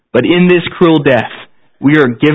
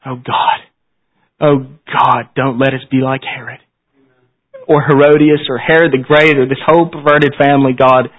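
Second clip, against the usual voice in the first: prayer. Acted. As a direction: pray humbly to close the service. Closing prayer after "Let's pray.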